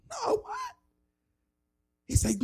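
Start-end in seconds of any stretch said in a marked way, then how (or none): 0.1-0.7 shooting-up high no, what; stunned disbelief. Retold or imitated. Teen told he spoke in tongues, high startled disbelief. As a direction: yelp in disbelief.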